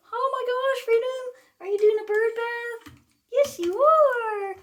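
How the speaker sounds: very high sing-song coo, slowing at the end; high pet talk. Real, not acted. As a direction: gush up high at the bird. Cooing to her parrot.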